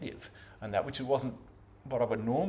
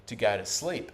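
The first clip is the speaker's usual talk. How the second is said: ordinary delivery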